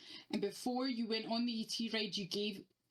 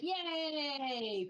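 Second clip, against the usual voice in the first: mock cheer; 6 semitones higher than usual. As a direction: celebrate mockingly. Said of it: "I was like".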